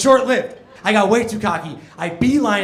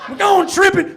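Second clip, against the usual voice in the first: higher, louder Southern bully chant; Southern repeat; 11 semitones higher, 6 dB louder than usual. Retold or imitated. Comic repeating the bullies' taunt, Southern accent. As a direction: toss off the Southern taunt again, laughing. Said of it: "I don't know why they were Southern".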